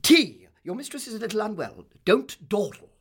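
loud, high, peremptory command; bossy, high-pitched calling; calling out orders. A narrator's reading. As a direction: order the servant about from the doorway. Aunt calls orders to servant.

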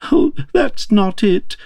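high, slow tearful wail; acted sobbing. A narrator's reading. Crying Queen corrects the King, sobbing. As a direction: wail it as a storybook weeping queen. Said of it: "sobbed Her Majesty".

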